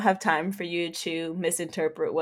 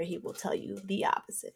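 ordinary delivery

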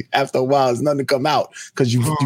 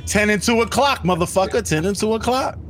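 high-pitched, insistent scolding; hammed-up high nagging; mock driving instructor; 11 semitones higher than usual. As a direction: drill it in like an irate driving teacher. Acted raging driving instruction.

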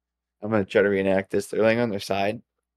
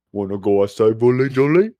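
dog voice; 2 semitones higher, 5 dB louder than usual. Retold or imitated. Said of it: "our voices went down for him".

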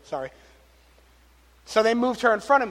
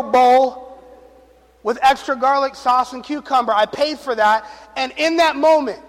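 louder, raised preaching voice; 5 semitones higher, 7 dB louder than usual. Acted. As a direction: drive the point home to the congregation. Pastor preaching the point.

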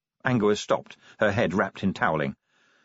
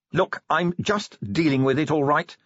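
higher-pitched irritable retort; a mild snap, defensive and higher; snapped; 7 semitones higher than usual. A narrator's reading. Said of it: "snapped Angua".

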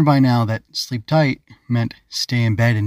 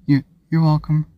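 mumbled; 5 semitones higher than usual. A narrator's reading. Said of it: "Ava mumbled".

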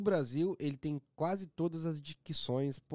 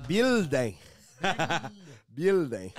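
mock Canadian; 5 dB louder, much slower than usual. Mocking the Canadian's pronunciation.

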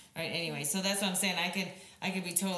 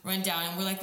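usual speaking voice

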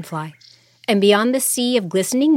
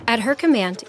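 ordinary delivery

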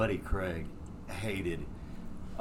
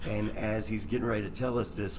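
ordinary talk

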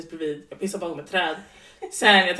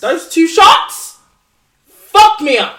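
slow, loud, stretched out; emphatic punchline; 8 semitones higher, 12 dB louder, slower than usual. Retold or imitated. Telling a friend how the shots wrecked her, loud and slow. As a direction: lean hard into every word.

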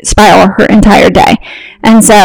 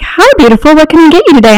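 sweet barista; 6 semitones higher, faster than usual. Retold or imitated. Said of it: "She was like".

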